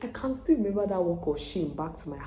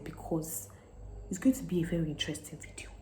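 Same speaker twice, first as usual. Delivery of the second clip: ordinary talk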